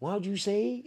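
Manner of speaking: high mock voice of a confused girl; bar girl. Barmaid asking what he said, high mock voice. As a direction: ask it like a baffled American barmaid. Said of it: "She goes".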